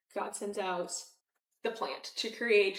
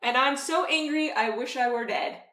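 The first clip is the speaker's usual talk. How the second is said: loud, higher defiant outburst; ballsy retort; 3 semitones higher, 7 dB louder than usual. Retold or imitated. Jonah talking back to God, loud and defiant. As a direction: talk back to God with nerve. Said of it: "is pretty ballsy and goes".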